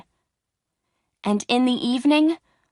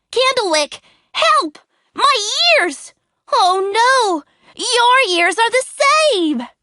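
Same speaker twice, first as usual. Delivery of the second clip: high, loud panicked pleading; 13 semitones higher, 6 dB louder than usual. A narrator's reading. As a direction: cry for help in panic. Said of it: "he cried".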